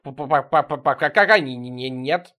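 terrified stuttering, drawn out; chattering teeth. A narrator's reading. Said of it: "Серёга под столом патетически заклацал зубами".

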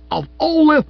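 very high, loud splutter; speechless outrage. A narrator's reading. Wife staring at the Times, speechless with outrage. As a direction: shriek it and break off. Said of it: "then words failed her".

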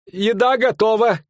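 high, drawn-out call; high-pitched innkeeper's cry. Acted. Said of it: "крикнул корчмарь".

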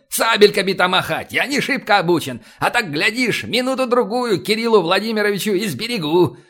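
put-on high-pitched, folksy old-timer; folksy old man. A narrator's reading. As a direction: justify yourself like a stubborn grandad. Old Tregubov voiced in dialogue.

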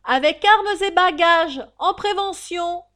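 high, very loud crier's call; full-force roll-call bark; shouted order. Acted. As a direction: bellow it across the prison yard. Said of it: "et cria".

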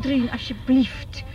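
loud plea. Acted. Smeekt dochter om te stoppen.

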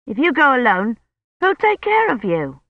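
put-on high, questioning boy's voice; worried young Colin. A narrator's reading. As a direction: pitch it up, challenging the adult.

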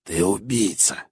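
slow, soft croaked accusation; hoarse old woman. A narrator's reading. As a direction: accuse in an old crone's rasp. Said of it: "прохрипел голос старухи". (хрипло, голосом старухи)

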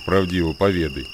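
normal talking voice

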